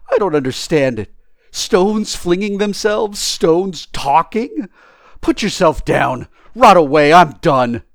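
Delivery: loud, high, incredulous tramp; bewildered tramp. Acted. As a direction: protest in disbelief, then give up loudly.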